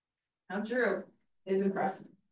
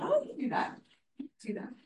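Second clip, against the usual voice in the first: lightly imitated student, pitched much higher; breezy student reply; 5 semitones higher, faster than usual. Student reassuring her teacher, in a high breezy voice. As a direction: toss it off high and easy, like the student.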